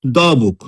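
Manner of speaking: দ্য said as short-vowelled "daw", not "dee"; consonant-sound article, দ্য as "daw"; "দ্য" before consonant. Shown as a demonstration. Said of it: "দি বুক বলবো না".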